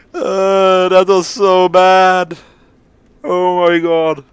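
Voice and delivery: loud, high, drawn-out exclamation; amused disbelief, stretched out; gleeful groaning. Real, not acted. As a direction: wail it slowly after cracking up. Recovering from laughing at the game.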